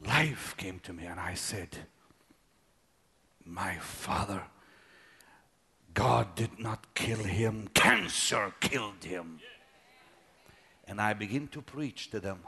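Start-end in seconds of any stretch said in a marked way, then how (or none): 3.5-9.4 low, slow and grave; grave, low funeral-pulpit declaration; solemn proclamation. Retold or imitated. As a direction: lay it down slowly like a verdict. Preaching at father's funeral.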